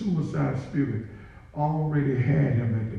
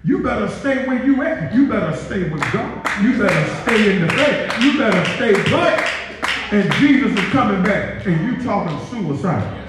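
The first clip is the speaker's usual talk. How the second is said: loud, high preacher's exhortation; louder fervent sermon call; preaching warning; 7 semitones higher, 4 dB louder than usual. Acted. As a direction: drive each line home to the church.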